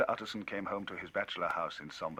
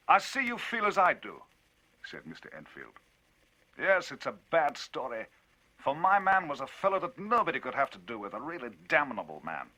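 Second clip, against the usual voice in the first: higher, louder, lively telling; Enfield, indignant storyteller; 9 semitones higher, 7 dB louder than usual. Acted. Enfield telling Utterson of the cheque, indignant and loud. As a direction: drive the tale on, indignant.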